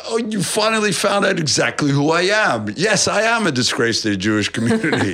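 high mock gratitude with a laugh; laughing sarcasm. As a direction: play it as a laughing sarcastic confession. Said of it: "I was like".

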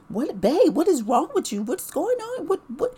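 high, loud, frantic questions; frantic alarm. Retold or imitated. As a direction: shake him with panicked questions. She panics at husband on floor.

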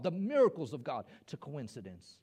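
normal talking voice

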